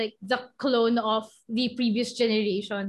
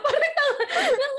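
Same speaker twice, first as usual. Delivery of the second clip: laughing; 14 semitones higher than usual. Real, not acted.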